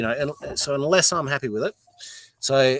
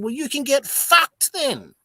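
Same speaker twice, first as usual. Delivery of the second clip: slow, higher mock tell-off, drawn out; drawn-out higher sneer, savour every word; 14 semitones higher, 5 dB louder, slower than usual. To the heckler, a slow mock tell-off. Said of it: "I said".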